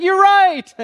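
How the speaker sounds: high, loud, excited praise; delighted praise. Retold or imitated. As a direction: cheer the child's answer. Pastor's excited reply to child.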